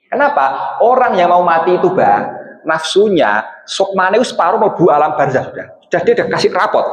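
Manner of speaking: ordinary talk, nothing marked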